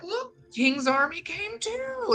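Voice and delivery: high, slow put-on voice for a casual "king's army came too"; mock movie character. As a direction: play a silly movie soldier, drawn out and airy.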